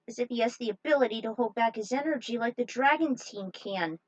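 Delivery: ordinary talk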